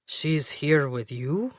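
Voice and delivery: disdainful. Acted. Bouncer's disdain at Marcus.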